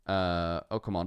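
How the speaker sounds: ordinary delivery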